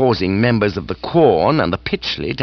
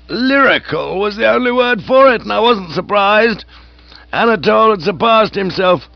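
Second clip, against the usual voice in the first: higher, louder, gushing aged aunt; hearty aunt voice; 11 semitones higher, 4 dB louder than usual. Acted. Aunt gushing to Bertie about lunch. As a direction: raise the pitch and relish the food.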